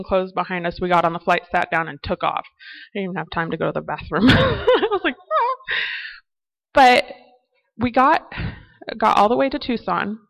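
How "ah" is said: exasperated cry. Retold or imitated. No time for the bathroom.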